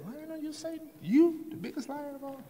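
high, astonished voice of a passerby; incredulous passerby. Acted. Passerby in the joke asks Satan, high and disbelieving. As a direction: squeak it out in disbelief.

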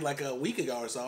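normal talking voice

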